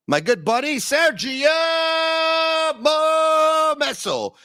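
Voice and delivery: host hypes his buddy like a PA announcer; announcer call. Pretend. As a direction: boom the name like introducing a player. Announces buddy's name, drawn out.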